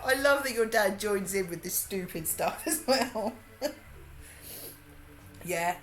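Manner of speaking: normal talking voice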